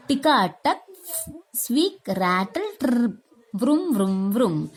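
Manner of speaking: imitating an old auto rickshaw rattling; mock engine sounds for children; engine noises.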